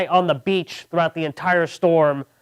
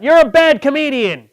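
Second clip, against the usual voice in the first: loud, high imitated heckle; heckler's yell; 9 semitones higher, 8 dB louder than usual. Heckler yelling at comedian mid-show, loud high shout. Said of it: "some guy yells out".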